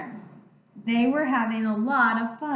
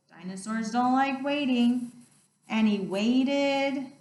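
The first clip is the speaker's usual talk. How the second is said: plain reading voice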